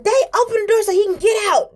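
put-on a toddler urging her dad to open the door; high hurried childish demand; child pleading. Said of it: "And so I said".

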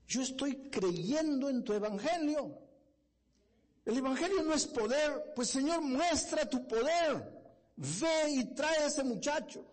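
high, loud, slow fervent prayer; bold pleading prayer. Retold or imitated. Young man praying boldly to God for the son, loud fervent cry. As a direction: command God to bring the boy, full force. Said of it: "Pero qué atrevido este muchacho".

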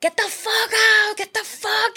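high, slow imitation of a coked-up yell; imitating him screaming to get out; mock yell. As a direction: ham up his screaming. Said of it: "empezó a gritar".

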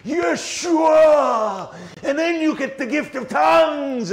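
shrieking high and loud, full tilt; screaming Pentecostal woman. Retold or imitated. Woman screaming at a street preacher, frantic shriek. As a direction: scream it in his face like a possessed street preacher. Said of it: "she's screaming in my face".

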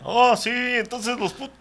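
high, slow mocking drawl; mock fake-cool kid voice. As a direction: imitate a poser kid. Mocking young guys' talk.

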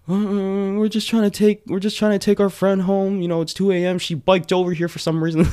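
high-pitched mock-innocent pleading; imitating flustered kids explaining to a cop; nervous excuse, imitated. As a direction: plead your case in a small high voice.

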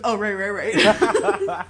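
slow, loud, laughing "oh right, right"; sheepish laughing backdown. Retold or imitated. Sabrina, waved off at the microphone, laughs and backs down. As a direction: laugh it off while backing down. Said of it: "And she goes".

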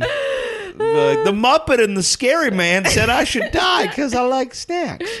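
mock outraged shout.

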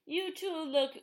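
loud hammed-up proclamation, falling at the end; old Greek man. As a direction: imitate an old man pronouncing on a couple. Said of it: "the old man that gave them the life water goes".

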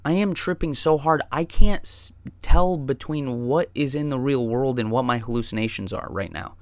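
tripping friend protesting the drive home; panicked rant. Retold or imitated. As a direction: build from exasperated to frantic. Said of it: "and I said".

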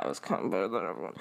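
low, quick, under the breath; muttered aside. Real, not acted. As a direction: drop it down and rush through like a sneaky confession. Under-the-breath confession undercutting the claim.

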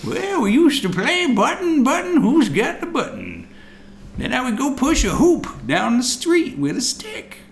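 put-on creaky old-timer reminiscing; old prospector. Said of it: "an old prospector".

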